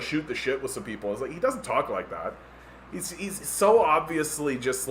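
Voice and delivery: ordinary delivery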